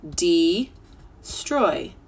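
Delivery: "destroy" drawn out slowly by syllables. Shown as a demonstration. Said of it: "We'll try it in syllables".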